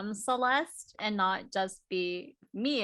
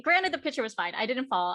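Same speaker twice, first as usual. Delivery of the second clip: ordinary talk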